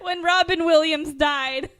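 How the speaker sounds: high, loud, slow tearful wail; tearful correction. Real, not acted. Host correcting which actor died, laughing and tearful. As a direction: drag it out loudly through tears.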